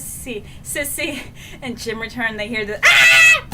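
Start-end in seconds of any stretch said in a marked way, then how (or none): 2.8-3.5 very high, loud short shriek; reenacted own scream. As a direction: let out a sudden piercing mock scream. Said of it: "Well, that's me".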